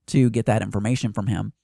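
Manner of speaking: ordinary talk, nothing marked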